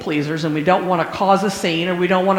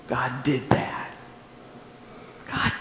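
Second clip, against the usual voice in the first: awed soft whisper; whispered awe; 6 semitones lower, 11 dB quieter than usual. Real, not acted. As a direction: breathe it out in wonder.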